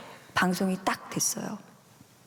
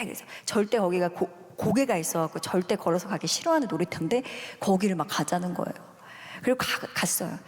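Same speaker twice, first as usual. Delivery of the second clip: ordinary talk, nothing marked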